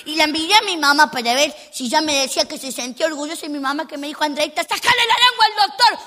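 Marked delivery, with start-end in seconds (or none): 4.2-6.0 high, sharp mother's voice; mother ordering. Retold or imitated. Mother tells her daughter to stick out her tongue, high and firm. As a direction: play the mom giving an order.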